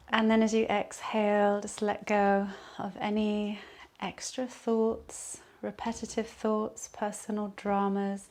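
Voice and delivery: calm Yoga Nidra voice with long pauses; guided meditation. Acted. Leads a relaxation meditation.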